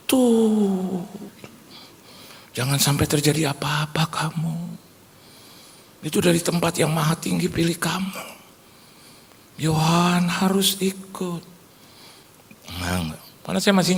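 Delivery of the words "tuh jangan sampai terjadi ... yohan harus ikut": higher, drawn-out motherly pleading; hint at a mother's solemn insistence; urging mother. Retold or imitated. Mother telling her son he is chosen, lightly imitated urging.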